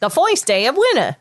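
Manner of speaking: put-on a light New York accent, "foist" with an oy; mild Bronx accent on "foist". Redoing the rabbit's line in his New York accent. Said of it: "That's exactly how he says it".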